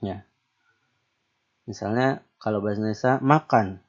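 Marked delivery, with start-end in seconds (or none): none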